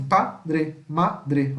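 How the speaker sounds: padre and madre split as PA, DRE, MA, DRE; syllable by syllable. Shown as a demonstration. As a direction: break padre, madre into separate beats. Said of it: "E ficam assim".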